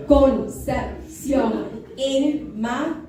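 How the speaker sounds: CONCEPCIÓN INMACULADA broken into slow, separate syllables; slow syllable-by-syllable count. Shown as a demonstration. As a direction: punch out each syllable with a gap after it. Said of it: "Let's count those syllables".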